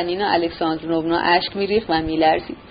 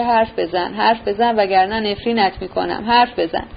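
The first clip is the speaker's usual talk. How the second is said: usual speaking voice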